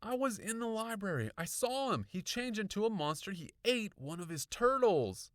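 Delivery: put-on high, agitated girl's voice; frantic. A narrator's reading. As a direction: urgently spill out a frightened report. Frantic girl reporting what she saw.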